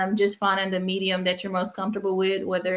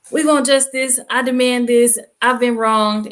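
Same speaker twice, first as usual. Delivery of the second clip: loud, raised-voice list of demands; mock demanding protest; 4 semitones higher, 9 dB louder than usual. Student shows how not to present it to faculty, mock demanding. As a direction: declare it like a protester's slogans. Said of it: "presenting it in such a way where it's not like".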